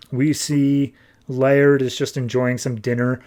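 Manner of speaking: ordinary talk, nothing marked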